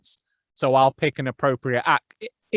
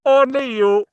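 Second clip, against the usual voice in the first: much higher, louder masked-villain voice; exaggerated villain pronunciation of "Only you"; Bane; 11 semitones higher, 6 dB louder, slower than usual. Narrator mimicking a film villain for laughs, exaggerated high Bane voice. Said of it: "the way he pronounces".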